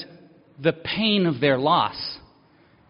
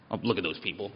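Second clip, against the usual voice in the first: snooty judging; 10 semitones lower, 9 dB quieter, faster than usual. Retold or imitated. Mocks his own judging attitude.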